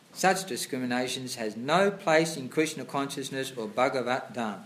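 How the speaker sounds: loud, formal reading of scripture; reading aloud. Acted. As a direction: read the passage out like a sermon. Reads purport aloud louder.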